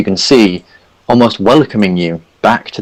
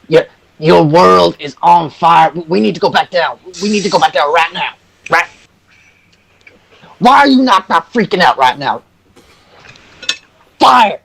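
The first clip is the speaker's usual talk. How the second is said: high put-on voice, frantic; panicking Hercule; 8 semitones higher than usual. Hercule begs the group to flee back down, high and frantic. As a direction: insist the world is burning, half hysterical.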